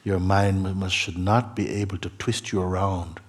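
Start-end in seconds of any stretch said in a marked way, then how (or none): none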